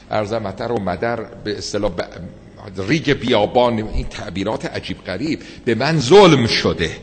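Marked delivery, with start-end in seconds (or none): 5.6-6.9 loud cry, ظلم hit hard and falling; wronged outcry. Retold or imitated. Preacher voicing the wronged man, loud outcry.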